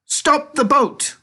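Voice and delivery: very high, loud, slow command; shouted. A narrator's reading. Wonka shouts to the rowers, very high slow command. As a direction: halt them with a sudden yell.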